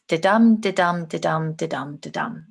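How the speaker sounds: "da-dum" repeated with an even beat on "dum"; regular rhythm. Shown as a demonstration. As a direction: caricature English rhythm, each "dum" stressed after a weak "da". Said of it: "stereotype of the way English sounds".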